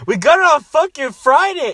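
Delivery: high, loud, exasperated insisting; insistent shout. Real, not acted. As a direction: shout it to settle the argument. Arguing over the date.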